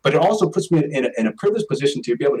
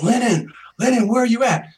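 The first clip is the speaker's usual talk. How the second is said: high mock yell, held back in volume; raised-pitch searching call, only half shouted; retold yelling; 8 semitones higher than usual. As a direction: imitate a friend calling out frantically for someone lost. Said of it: "yelling and screaming".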